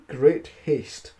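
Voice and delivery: plain reading voice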